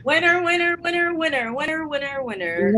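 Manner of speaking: high singsong winner chant; hyped giveaway announcement. Real, not acted. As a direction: chant it like a game-show host.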